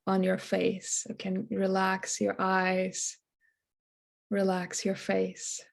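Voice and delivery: soft, unhurried meditation voice; guided meditation. Acted. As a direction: gently ease listeners into relaxation, leaving long pauses. Leads into a meditation.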